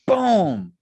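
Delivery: punchy high burst of enthusiasm; excited exclamation. Real, not acted. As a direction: blurt it out with excitement. Exclaiming about street food.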